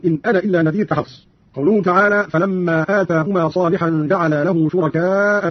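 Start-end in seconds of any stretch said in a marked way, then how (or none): 0.0-0.9 أنا cut short as ana; no long ā in أنا before إلا; alif dropped. Shown as a demonstration. Teacher demonstrating the dropped alif, أنا said short. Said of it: "وله الحذف أيضًا".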